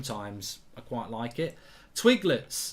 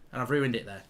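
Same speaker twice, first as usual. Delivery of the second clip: normal talking voice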